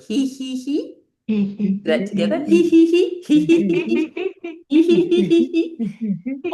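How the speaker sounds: high, loud hee hee; tittering hee laugh; throat hee hee. Shown as a demonstration. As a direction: giggle from the throat. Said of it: "you're just gonna go".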